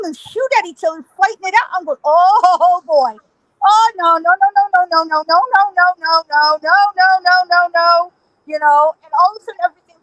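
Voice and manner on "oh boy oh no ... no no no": raised, unbroken mock-panicked chain of no's; alarmed dismay. Witness to a ghost gunfight, acted panicked dismay. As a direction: let the no's run on in rising alarm.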